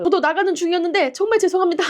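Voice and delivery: high, pleading apology; re-enacted high-voiced sorry; acted flustered apology. Driver apologising to annoyed couple.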